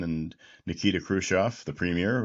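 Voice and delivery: ordinary delivery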